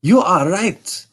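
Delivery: the king gives in, crying out; cried out. Acted. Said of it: "he cried".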